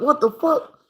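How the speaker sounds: high, startled yelp; panicked shock. Retold or imitated. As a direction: caught off guard in the ditch. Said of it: "We like".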